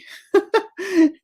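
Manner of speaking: a high, bubbling laugh. Real, not acted. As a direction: laugh at yourself. Laughs at her own story.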